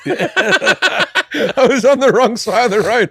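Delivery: real laughter through the words; laughing. Real, not acted. Laughing at his own mistake.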